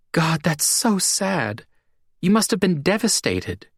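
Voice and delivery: Jean, saddened. A narrator's reading. Jean moved by the story.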